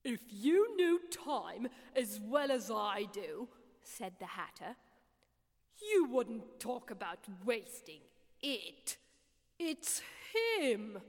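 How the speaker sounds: slow and self-important, the Hatter lectures; lightly voiced Hatter. A narrator's reading.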